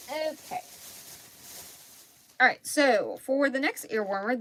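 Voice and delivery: normal talking voice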